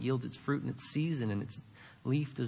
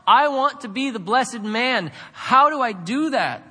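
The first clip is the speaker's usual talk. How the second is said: loud, high, impassioned plea to God; modelled prayer; 9 semitones higher, 8 dB louder than usual. Shown as a demonstration. Preacher showing how to pray honestly from the Bible, impassioned prayer. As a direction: confess it forcefully, as a preacher praying aloud. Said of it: "have a conversation with God".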